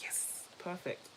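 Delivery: slow, soft mock whisper of schemers. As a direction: breathe it out like a plotting whisper. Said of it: "They were like".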